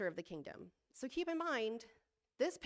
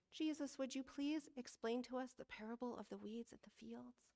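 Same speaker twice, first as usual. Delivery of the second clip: soft, measured, polite scripture reading; hushed, reverent disciple asking politely; good Bible reading voice; 3 semitones higher, 9 dB quieter than usual. Acted. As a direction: read it gently like a proper Bible reader. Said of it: "in my good Bible reading voice".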